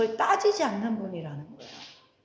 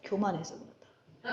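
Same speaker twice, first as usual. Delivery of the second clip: softer, flat-voiced classmate's put-down; mock deadpan jab, softer; dry quoted rebuke; 5 semitones lower, 11 dB quieter than usual. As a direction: deliver the jab quietly and deadpan. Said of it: "그분이 뭐라 했는지 알아요?".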